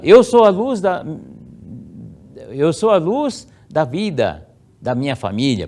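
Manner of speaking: first-person affirmation. Shown as a demonstration. Child reciting the prayer to self, high and solemn. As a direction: proclaim it to yourself. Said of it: "você vai ler assim".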